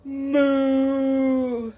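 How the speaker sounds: long droning moo; engine moo. A narrator's reading. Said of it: "sounds like".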